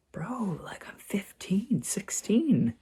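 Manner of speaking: hushed, high, incredulous inner voice; whispered disbelief. Retold or imitated. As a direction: whisper it like an exasperated thought. Teen's disbelieving thought at dad's nagging.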